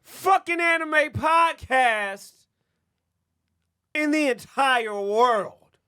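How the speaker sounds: high, loud, slow announcer boom; hype announcer. Acted. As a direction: oversell it like a movie trailer. Podcast plug, announcer hype.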